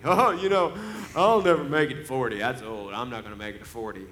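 lightly voiced carefree young man, laughing it off; a hint of breezy bravado; joking. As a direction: toss it off like a joke. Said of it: "he says it jokingly".

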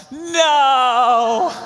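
drawn-out, hammed-up howl of letdown; anguished cry. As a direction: cry out like the prize just slipped away. Disappointed at timeshare offer.

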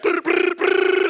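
high, loud mock engine sputter; playful motor noise for the crowd; imitated sputtering motor. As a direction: buzz your lips like a dead outboard. Stalled boat motor imitated.

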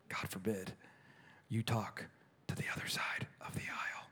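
whispered mock-scandalised aside; stage whisper. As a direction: drop to a whisper as if it were taboo. Sarcastic aside in sermon.

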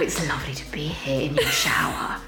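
playful put-on seductive whisper; mock sexy whisper. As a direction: whisper it like a sultry cartoon cat. Keeps up the joke sexy voice.

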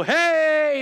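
loud drawn-out hey; announcing himself. Retold or imitated. Friend popping in through the kitchen, calling out. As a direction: call out to announce yourself, mock style. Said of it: "go, 'Hey,' and just announce myself".

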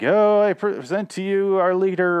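high, drawn-out proclamation; mock-grand presentation. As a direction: present someone grandly to the leader, slow and high. Acting out a cult introduction.

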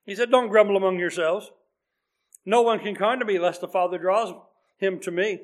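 normal talking voice

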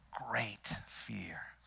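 hushed whisper; whispered sermon aside. Real, not acted. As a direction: breathe the words out in awe. Preacher hushes on fear.

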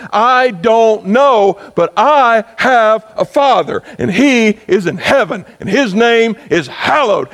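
building fervent prayer. Pretend. Preacher voicing a believer's prayer to the Father, building to fervent. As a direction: build slowly from worry into bold trust.

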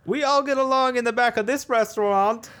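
very high silly put-on voice; hammed-up restaurant character. Worker in the back of the restaurant, hammed-up high voice. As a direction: pitch it way up, mock cheery.

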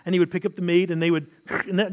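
ordinary talk

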